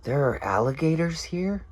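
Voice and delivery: high, rising imitation of a credulous woman; mock astonished question. Woman learning of alligators nearby, mock high disbelief. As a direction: climb in pitch to a wide-eyed "here?".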